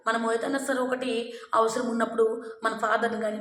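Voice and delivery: usual speaking voice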